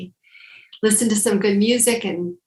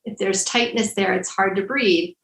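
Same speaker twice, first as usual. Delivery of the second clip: usual speaking voice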